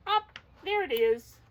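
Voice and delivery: high, loud burst of delight; excited find. Real, not acted. Teacher to the children, high and loud with excitement. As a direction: cheer as the page turns up.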